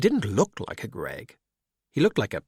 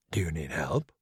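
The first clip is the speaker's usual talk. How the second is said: quiet, lower, almost breathed; low murmur; 7 semitones lower, 7 dB quieter than usual. Acted. Skullduggery on the phone to Valkyrie, hushed and low. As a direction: check in discreetly down the line.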